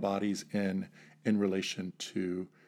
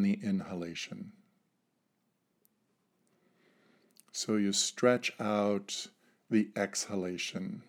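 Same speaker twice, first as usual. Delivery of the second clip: guided meditation. Acted. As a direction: lead them gently into calm breathing. Leads a breathing exercise.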